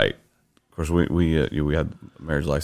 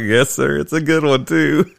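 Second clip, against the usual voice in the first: high, laughing yes-sir; laughing mock agreement; 10 semitones higher, 5 dB louder than usual. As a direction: agree nervously through a laugh.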